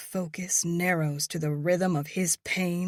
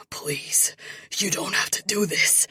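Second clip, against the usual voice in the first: trembling voice; 4 semitones higher, 4 dB louder than usual. A narrator's reading. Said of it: "His voice trembles".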